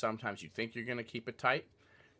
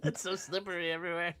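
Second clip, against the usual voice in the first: laughing; 6 semitones higher, faster than usual. Real, not acted. Laughs while speaking.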